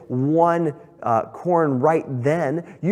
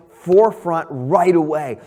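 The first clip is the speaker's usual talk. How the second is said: usual speaking voice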